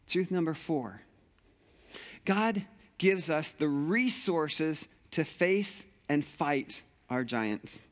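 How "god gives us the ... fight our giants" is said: very slow, weighty delivery; slow emphatic. Acted. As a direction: lay each word down slowly. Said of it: "most important thing you need to hear".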